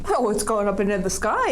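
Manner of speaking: plain reading voice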